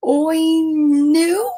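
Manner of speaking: long "Oi" gliding upward at the end; pig trying again. Acted. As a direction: stretch out a failed oink, ending questioningly. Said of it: "And again".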